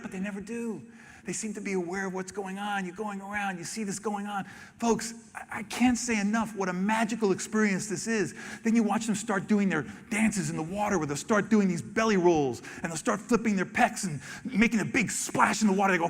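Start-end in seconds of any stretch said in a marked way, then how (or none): none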